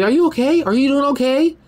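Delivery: high, sugary fake-caring voice; mock fake concern. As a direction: mimic a fake friend pretending to care. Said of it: "people that you ain't been cool with come to you".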